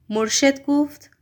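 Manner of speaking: plain reading voice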